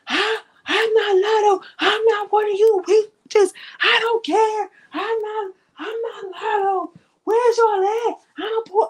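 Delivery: high, strained mock scream of a raging woman; imitating a furious scream on a leaked call; imitated screaming. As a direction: screech it like she's losing it.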